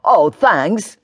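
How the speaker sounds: put-on mildly indignant teenage boy; a touch of wounded sarcasm. A narrator's reading. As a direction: take offence, lightly. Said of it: "says Ben indignantly".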